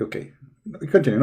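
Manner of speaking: normal talking voice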